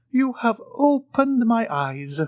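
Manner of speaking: slow, high, mock-solemn old Italian; grave. A narrator's reading. The Italian gravely thanks the count, slow and high. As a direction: declare it with put-on gravity. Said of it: "said the Italian gravely".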